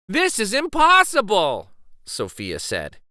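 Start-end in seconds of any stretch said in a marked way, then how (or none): 0.1-1.7 frustrated complaint. Acted. Sophia falls off wall.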